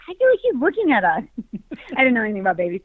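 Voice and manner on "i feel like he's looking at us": high, quick, wide-eyed wonder; amazed new dad. Retold or imitated. New father marvelling at his baby, high and quick. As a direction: marvel that the baby sees you. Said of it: "but I was like".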